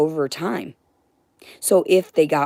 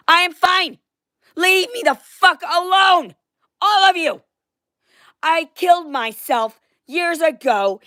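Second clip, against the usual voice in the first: furious rant; 6 semitones higher than usual. Real, not acted. Ranting at people who intrude, building to a high furious shout. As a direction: voice climbing from angry to high shouting.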